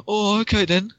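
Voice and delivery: high, startled acceptance; suddenly backing off; taken aback. Retold or imitated. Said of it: "mocking sick shamer exchange".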